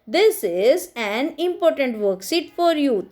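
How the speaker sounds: each word spaced out slowly for the class; slow dictation. Shown as a demonstration. Teacher to her students, slow dictation. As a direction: dictate it slowly so children can write.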